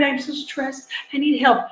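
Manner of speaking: a hushed, whiny imitation of a stressed parent; quietly mimicking a parent begging for help; mock stressed parent. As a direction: mimic a worn-out mother pleading. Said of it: "And you're crying".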